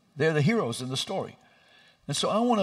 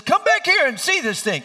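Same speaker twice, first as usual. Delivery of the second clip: much higher, loud cheerful call; eager doctor; 13 semitones higher, 7 dB louder than usual. Retold or imitated. Doctor calling father to see the surgery, high eager call. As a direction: wave him over excitedly. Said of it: "He says".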